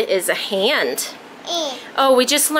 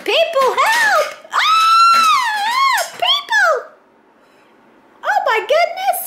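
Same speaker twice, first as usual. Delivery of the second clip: very high squeaky voice crying out; tiny high-pitched figure calling for rescue; mock cry for help; much slower than usual.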